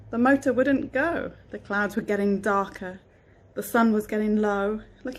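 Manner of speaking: storybook reading voice; reading to children. A narrator's reading. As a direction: read it gently to a child. Reads a picture book aloud.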